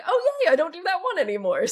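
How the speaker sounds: high, fast, laughing little cheer. Retold or imitated. Self-coach laughing at her own progress, high quick cheer. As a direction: gleefully celebrate a dropped habit. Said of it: "And other times I'm like".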